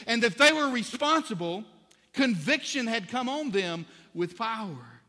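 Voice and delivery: high, loud impassioned preaching; preaching at full force. Acted. Pastor preaching fervently to his church, loud and high. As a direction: drive it home to the congregation.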